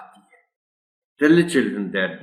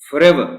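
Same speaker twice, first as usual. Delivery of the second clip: "Forever" said louder, each syllable clear; vocabulary word stressed; 7 semitones higher, 10 dB louder, much slower than usual. Shown as a demonstration. Teacher presents the new word "Forever", emphatic. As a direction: announce "Forever" loudly with a falling end. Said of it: "Some words and their meanings".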